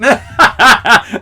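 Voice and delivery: high, loud burst of laughter; embarrassed laughing burst; real laugh. Real, not acted. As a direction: laugh out at yourself. Laughs at his own embarrassment.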